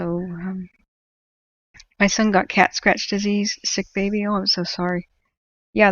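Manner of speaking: usual speaking voice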